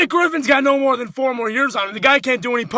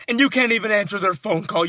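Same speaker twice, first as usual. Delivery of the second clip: ordinary talk, nothing marked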